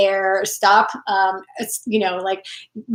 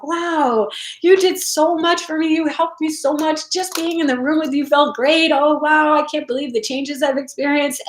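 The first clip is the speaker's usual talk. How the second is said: imitating gushing thankful clients, voice higher; grateful people; 6 semitones higher than usual. Quoting people who thanked her, mock gushing praise. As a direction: play the delighted admirer, a little raised. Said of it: "people are like".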